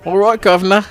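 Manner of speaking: high-pitched mock English accent. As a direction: put on a hammy Cockney-style English accent. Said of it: "I'm gonna start talking in an English accent".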